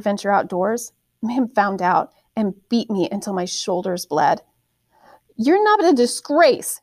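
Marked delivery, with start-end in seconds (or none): 5.4-6.8 put-on high, slow, loud scream at her child; a mother screaming in slow contempt; shrieking each word out slowly. A narrator's reading. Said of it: "she screamed".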